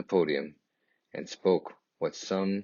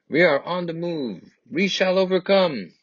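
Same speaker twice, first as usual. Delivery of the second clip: high, loud rallying call of a marching crowd; marchers' rallying cry; 11 semitones higher, 5 dB louder than usual. Acted. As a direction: raise the voice high like chanting marchers. Marchers chanting together.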